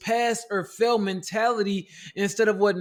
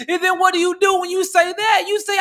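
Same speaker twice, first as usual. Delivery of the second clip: climbing higher and louder with each question until shouting; building to a shout; 7 semitones higher, 5 dB louder than usual. Acted. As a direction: wind it up step by step into a full preaching shout. Escalating rhetorical chain, self-talk.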